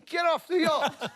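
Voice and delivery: high-pitched call out to a yacht; mock yell. Man hailing Rod on his yacht, mock yell. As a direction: call up to someone far off, pitch leaping. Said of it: "You yelled out to his yacht".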